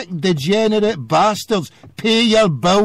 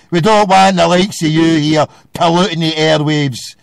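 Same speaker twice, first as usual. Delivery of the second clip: normal talking voice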